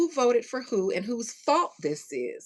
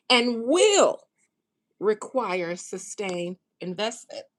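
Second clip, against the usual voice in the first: louder on not optional, slow start; loud emphatic quote; 6 dB louder than usual. Retold or imitated. Quoting Fink to viewers, punching not optional loud. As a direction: read the quote with rising force. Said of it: "this is a quote".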